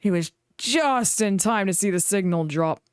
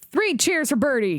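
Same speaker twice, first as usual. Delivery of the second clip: calling out; 7 semitones higher than usual. A narrator's reading. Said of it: "called the passengers".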